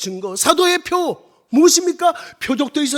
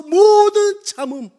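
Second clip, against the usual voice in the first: 모든 stretched long and raised; drawn-out emphasis; 6 semitones higher, 5 dB louder, much slower than usual. Real, not acted. Preacher gives the first sign of apostleship, 모든 drawn out in emphasis. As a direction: hold 모든 out slowly before 참음. Preacher proclaims the key phrase.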